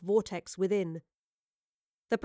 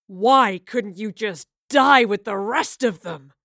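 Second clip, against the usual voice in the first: slow, loud anguished scream; raging at the heavens; 9 dB louder, slower than usual. A narrator's reading. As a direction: scream it up at the sky. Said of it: "he screamed to the heavens".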